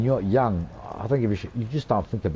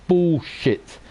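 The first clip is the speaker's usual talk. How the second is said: a sharp, higher dismissive bark; scornful bullshit; 7 semitones higher than usual. Real, not acted. Vlogger rejects the saying to his viewers, scornful. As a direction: spit it out in contempt.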